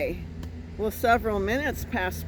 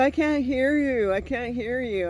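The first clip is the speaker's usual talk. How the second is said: higher-pitched call through a door; raised, insistent retelling of his call; imitated stranger calling; 5 semitones higher than usual. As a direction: imitate an older guy who can't hear.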